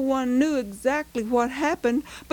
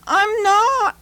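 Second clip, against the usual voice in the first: put-on protesting boy; 8 semitones higher, 7 dB louder than usual. A narrator's reading. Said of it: "Pete replied".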